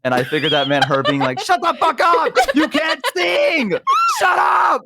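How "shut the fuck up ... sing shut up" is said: high, drawn-out wailed rant; mock howling-dog protest. Friend voicing her howling dog's complaint, high wail. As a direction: howl the words like an offended dog.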